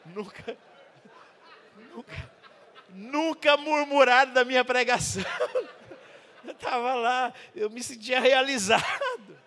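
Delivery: loud laughter through the words; laughing loud. Real, not acted. Preacher laughing at his own joke to the congregation. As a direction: laugh it out big, half shouting.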